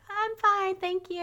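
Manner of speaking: high clipped brush-off; daughter's breezy dismissal, high. Retold or imitated. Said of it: "she's like".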